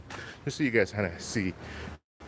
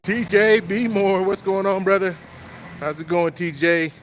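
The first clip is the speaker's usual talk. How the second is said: high, loud, drawn-out greeting to a viewer; excited shout-out; 8 semitones higher, 5 dB louder than usual. Real, not acted. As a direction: call his name out big and slow, like spotting a friend.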